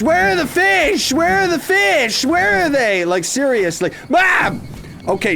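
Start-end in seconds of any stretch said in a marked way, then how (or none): 0.0-3.0 frantic searching. Real, not acted. 4.1-4.5 high sharp yelp of surprise; startled yelp. Real, not acted. Hit in game.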